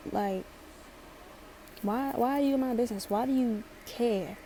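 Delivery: ordinary talk, nothing marked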